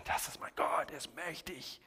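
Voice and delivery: awed whisper; whispered awe. Shown as a demonstration. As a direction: whisper it in wonder. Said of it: "wir sagen".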